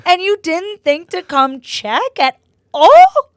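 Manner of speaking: very high, loud, incredulous protest; retold anger. Upset daughter asking mom why nobody checked, mad. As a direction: demand an answer, slow and outraged. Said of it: "I'm crying and I'm mad".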